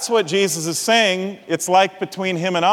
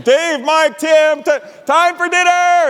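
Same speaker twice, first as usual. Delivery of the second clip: high, loud call across the yards; a mother calling her kids in to eat; mom's dinner call; 9 semitones higher, 4 dB louder, slower than usual. Retold or imitated. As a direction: holler the boys home for dinner.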